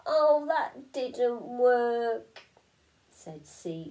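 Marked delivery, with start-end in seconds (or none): 0.1-2.4 slow, deflated little fish voice; miserable. A narrator's reading. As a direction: let the disappointment drag. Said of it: "said Sneezy miserably".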